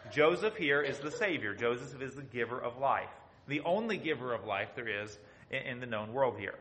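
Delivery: ordinary delivery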